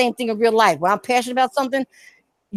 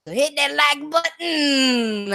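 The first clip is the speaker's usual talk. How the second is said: high, slow, stretched-out coaxing; drawn-out sing-song plea; 7 semitones higher, much slower than usual. Acted. Streamer coaxing viewers to hit like, slow sing-song. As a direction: coax them into hitting like, drawn out.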